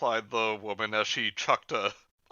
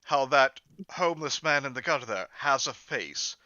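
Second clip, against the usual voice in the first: ordinary delivery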